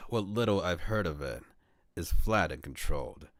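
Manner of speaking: ordinary delivery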